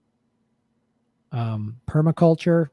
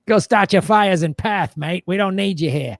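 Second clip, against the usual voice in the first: badly put-on Australian accent; awful Australian accent; 3 semitones higher than usual. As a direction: imitate a dismissive Australian local. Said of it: "My awful Australian accent".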